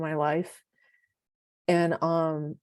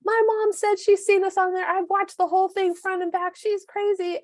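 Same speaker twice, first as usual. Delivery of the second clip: much higher, louder, breathless imitation; excited friend; 14 semitones higher, 4 dB louder than usual. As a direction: gush it like big news on the phone. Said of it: "You're like".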